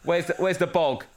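cheeky geezer calling oi love; imitating kids as lads. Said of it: "Oi love".

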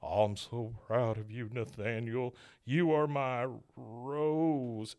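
soft last words, slowing right down at the end; hammed-up deathbed farewell; dying movie father. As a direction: stretch the final words like a dying breath.

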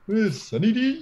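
high cartoon-bear imitation; Yogi Bear. Teasing a co-host for how he said it, cartoon-bear voice. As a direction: mimic your co-host as a goofy cartoon bear. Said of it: "sound like Yogi Bear".

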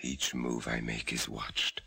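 low hushed whisper. Acted. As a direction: whisper so the jailers can't hear. Whispering while watched.

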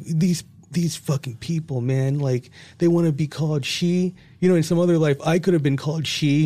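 high-pitched, worked-up mock rant; retold anger. Retelling an angry outburst to partner about Caitlyn Jenner, mock indignant. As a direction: act out your old indignation, voice pushed up. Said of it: "I was really angry".

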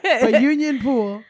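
slow, loud, laughing through it. Real, not acted. Host laughing as she names the bar, slow and loud. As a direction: laugh the name out, slowly.

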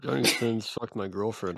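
usual speaking voice

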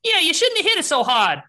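loud, high-pitched troll squawk; very high, loud troll, squarely at the other one. Acted. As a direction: scold in a shrieky high troll voice. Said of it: "is the higher pitched voice".

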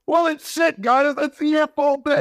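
high-pitched, drawn-out scolding; exasperated retort. Retold or imitated. Viewer blaming characters who signed blindly, exasperated.

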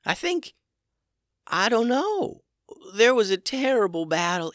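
a small survivor still scared; frightened little dragon. A narrator's reading. Little dragon recounts terrible battle.

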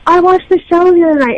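high, loud gushing fan voice; excited fan quote. Retold or imitated. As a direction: play the eager fan who recognized her. Fan quoted approaching at party.

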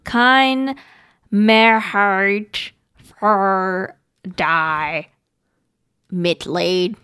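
halting put-on German accent, word by word. Reading a German phrase on request, in a mock German accent. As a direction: sound it out in a hammy German accent. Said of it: "Why did you put on that accent?".

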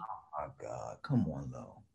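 whispered in disbelief, barely voiced; a hushed, embarrassed oh my God to a friend. Real, not acted. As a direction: breathe it out like you can't believe her.

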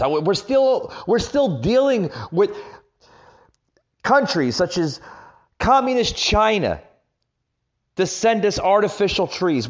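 high, loud and heated; fired-up ranting, pushing hard; angry rant. Real, not acted.

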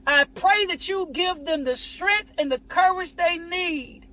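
hushed, unhurried intercession; prayer. Acted. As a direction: pray it slowly over them. Leads a prayer.

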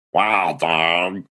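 a light stab at a rapper's catchphrase; half-hearted rapper voice that surprises himself. Acted. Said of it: "I was trying to do a [name] impression".

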